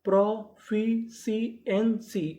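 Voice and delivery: "Proficiency" very slowly, a gap between sounds; teacher building "proficiency" from its parts; syllable by syllable. Shown as a demonstration. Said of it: "तो पूरी meaning क्या बनी".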